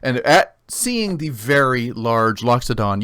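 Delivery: usual speaking voice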